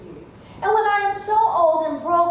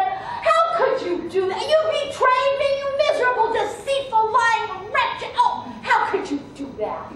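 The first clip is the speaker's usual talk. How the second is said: raging snake; 8 semitones higher than usual. Acted. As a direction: rage at the traitor. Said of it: "He was raging".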